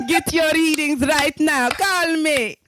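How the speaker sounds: high, slow Jamaican hotline pitch; Jamaican accent. Retold or imitated. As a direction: sell it like a Jamaican TV psychic. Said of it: "a Jamaican accent to talk like Cleo".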